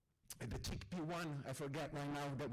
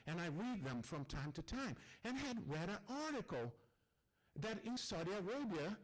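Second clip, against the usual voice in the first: usual speaking voice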